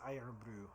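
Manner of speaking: Irn-Bru with first word like "iron"; iron pronunciation. Shown as a demonstration. Deciding what we're gonna say, Irn-Bru as "iron-brew". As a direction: say "Irn-Bru" as "EYE-urn-broo". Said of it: "We'll pretend it's pronounced like iron".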